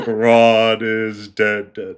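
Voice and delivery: eerie, very slow imitation; scary voice. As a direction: drag the words out like a creepy slowed recording. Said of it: "It sounds so fucking scary".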